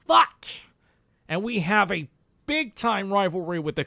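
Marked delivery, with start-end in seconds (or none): 0.1-0.4 much higher, louder frustrated curse; frustrated shout. Real, not acted. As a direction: spit it out in frustration. Season ended.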